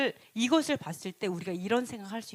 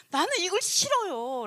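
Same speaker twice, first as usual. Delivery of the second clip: high-pitched acted protest; defiant refusal; 7 semitones higher than usual. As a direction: refuse like a balking complainer. Acts out refusing God's will.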